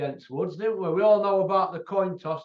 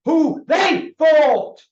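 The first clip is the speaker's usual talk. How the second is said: slow, higher, loud yell; emphatic shout; 7 semitones higher, 10 dB louder, much slower than usual. Real, not acted. Arguing the boxer's record to the viewers, a slow shout. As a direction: hammer it home, slowly. Ranting about fighters' records.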